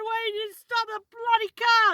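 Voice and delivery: very high-pitched indignant demand; retold outrage. Storyteller scolding mum over the car, outraged yell. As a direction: re-enact shrieking blame at mum.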